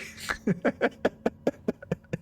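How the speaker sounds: breathy, rapid giggling fit; real laughter. Real, not acted. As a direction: crack up at your own impression. Laughing at own impression.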